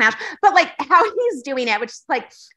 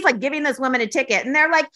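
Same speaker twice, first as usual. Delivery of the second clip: ordinary talk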